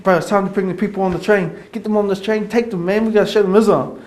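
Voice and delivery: high, loud eager greeting; loud high-pitched zealous excitement; hyped up. Retold or imitated. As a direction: fire everyone up to join. Urging brothers, hyped.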